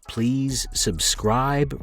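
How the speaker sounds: plain reading voice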